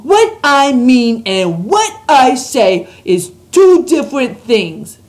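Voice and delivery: loud, slow and grand giant voice; grand announcement. A narrator's reading. Giant announcing to Sophie, grandly and slowly. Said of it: "announced rather grandly".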